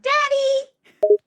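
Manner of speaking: sudden high-pitched squeal; oddly said. A narrator's reading. Complaining about creepy nicknames, oddly squealed word. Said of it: "Why did you say it like that?".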